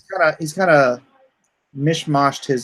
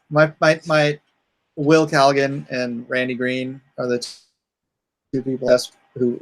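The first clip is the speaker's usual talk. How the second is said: ordinary talk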